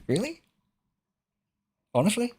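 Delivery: incredulous squeak. Real, not acted. Disbelief at chat's praise.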